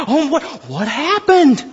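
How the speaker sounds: slow, fake-innocent surprise; hammed-up pretend shock; mock surprise. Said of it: "pretended to be surprised".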